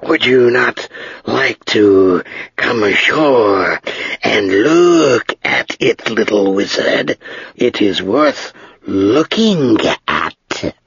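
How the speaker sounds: slow, drawn-out, dry dragon voice; great dry voice of an ancient dragon. Acted. Dragon speaks to Ged.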